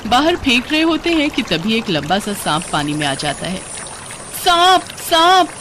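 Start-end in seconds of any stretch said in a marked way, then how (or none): 4.4-5.5 high, loud, drawn-out scream of alarm; terrified cry. Acted. Woman spotting a snake in the floodwater, terrified. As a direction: shriek a warning in panic.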